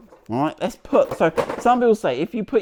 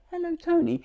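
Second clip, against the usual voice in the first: high, tiny talking-key voice; Tony, little voice; 12 semitones higher than usual. Acted. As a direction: pipe up like a little gadget calling out its name. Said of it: "says it in a little whisper".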